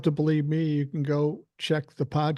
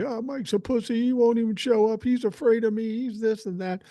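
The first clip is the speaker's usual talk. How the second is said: mocking Jeff's taunts; 7 semitones higher than usual. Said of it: "So he's telling everybody".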